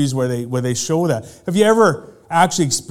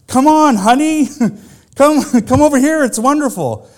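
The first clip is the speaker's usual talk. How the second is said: high, bright coaxing call to come in; high sing-song wife's invitation from the water; wife calling sweetly; 10 semitones higher, 5 dB louder than usual. Retold or imitated. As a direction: lure him into the water, sing-song and eager.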